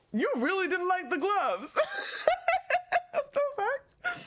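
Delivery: very high, real giggles breaking in; incredulous, cracking up as you talk; laughing disbelief. Real, not acted. Laughing at remark about gloves.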